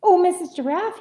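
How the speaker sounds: high, loud eager chick; little bird crying out. A narrator's reading. Little bird hails a giraffe, crying out. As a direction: call out hopefully as a small bird. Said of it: "he cried".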